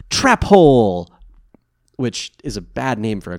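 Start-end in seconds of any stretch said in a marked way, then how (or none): 0.1-1.1 drawn-out loud "trap hole" shout; hammed-up battle cry, stretched long; mock triumphant call. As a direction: yell it out slowly like a card attack. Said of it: "he spins around and he goes".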